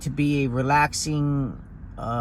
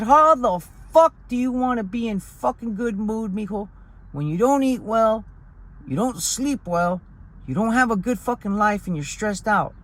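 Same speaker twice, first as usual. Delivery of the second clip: higher, exasperated grandpa voice; scolding grandfather; 6 semitones higher than usual. Retold or imitated. Grandfather telling his grandson why he's in a bad mood, higher and exasperated. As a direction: scold like a grandfather who knows better.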